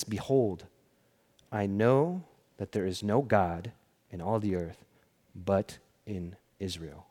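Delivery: low, soft acted reverence, slowing at the end; hushed solemn declaration. Healed commander confesses to the prophet, hushed and solemn. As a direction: recite it softly and gravely, with weighty pauses.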